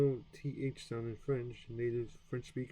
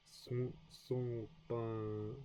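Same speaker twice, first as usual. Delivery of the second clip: son pas drawn out very slowly, sound by sound; careful slow son pas; slow practice; 3 dB quieter, much slower than usual. Shown as a demonstration. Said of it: "practicing pronunciation slowly".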